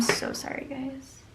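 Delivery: sheepish whisper; whispered apology. Real, not acted. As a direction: whisper a quick sorry. Apologizing after noisy writing.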